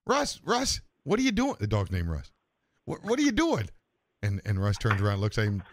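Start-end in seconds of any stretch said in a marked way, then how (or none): 0.1-1.6 high-pitched, incredulous calling out; acted shock at a misbehaving dog; shocked dog owner. Startled owner catches dog in joke. 2.8-3.7 high, incredulous demand; acted disbelief, high and scolding; shocked dog owner. Owner repeats shocked question to dog.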